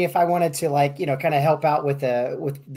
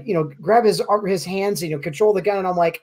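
normal talking voice

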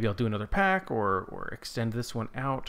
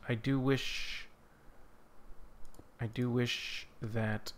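ordinary delivery

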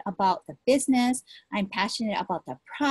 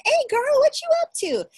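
put-on high, bright call to an old friend; excited greeting; 5 dB louder than usual. Spotting an old high school classmate in the food aisle, high excited hello.

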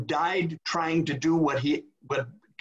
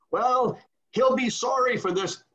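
indignant warning pitched up high; high, loud threat; 10 semitones higher, 3 dB louder than usual. Retold or imitated. Cardinal Billow threatening the departing bishop, high and loud. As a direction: spit out the threat with wounded pride.

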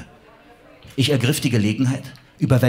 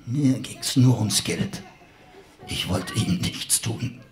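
weak whispering villain; 5 dB quieter than usual. Acted. Dying villain whispers his justification.

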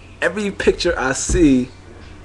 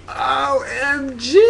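much higher, slow drawn-out O M G; mock girly squeal; 13 semitones higher, slower than usual. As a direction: squeal it like a silly selfie girl. Mocking girls in photos.

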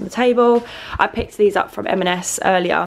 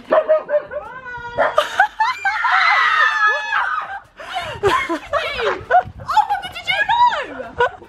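high shrieking laugh. Real, not acted. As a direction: burst out laughing. Big laugh at surprise.

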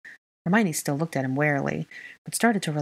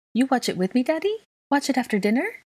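put-on very high, rising little-kid question; hopeful child; 10 semitones higher, 3 dB louder than usual. A narrator's reading. Child asking Daddy to watch television together, hopeful. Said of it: "Aurelian asked hopefully".

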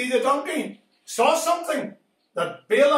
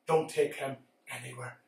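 hushed command; 11 semitones lower, 10 dB quieter than usual. Acted. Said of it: "God says to the donkey".

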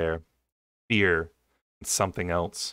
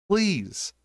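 much higher desperate plea; begging; 11 semitones higher than usual. A narrator's reading. Narrator begging the king to spare her sister, desperate. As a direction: beg the king with everything. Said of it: "I begged the king".

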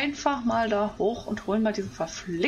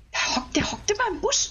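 high, gleeful call-out; pitched-up gotcha; excited spotting; 11 semitones higher than usual. Real, not acted. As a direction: point out the camper with glee. Spots hiding player.

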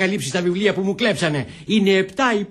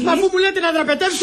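high-pitched indignant protest; shouted protest; 11 semitones higher than usual. Acted. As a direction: protest without lowering the voice. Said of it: "τι φωνάζεις".

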